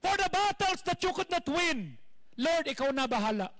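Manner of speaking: loud high preacher's exhortation; preaching at full force. Acted. Pastor urging the congregation, loud fervent preaching. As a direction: preach it out to the crowd with fire.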